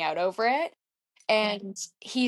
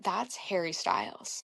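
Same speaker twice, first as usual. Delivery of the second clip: slow, softened recognition of a star; hushed realisation; 2 semitones lower, 7 dB quieter than usual. Retold or imitated. Runner spotting a celebrity in the line, hushed. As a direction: drop your voice as it dawns on you.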